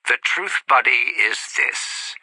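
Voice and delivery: ordinary talk, nothing marked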